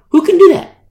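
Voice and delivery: high, loud preacher challenge; rhetorical cry. Acted. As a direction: throw the question out to the room.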